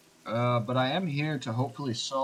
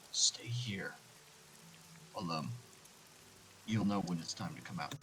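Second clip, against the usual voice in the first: unvoiced soft whisper; 5 semitones lower, 12 dB quieter than usual. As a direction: hush the youngsters. Player whispers to youngsters.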